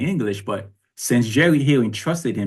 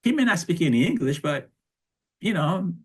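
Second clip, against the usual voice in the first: gym teacher voice; 8 semitones higher than usual. Retold or imitated. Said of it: "those gym teachers saw me and say".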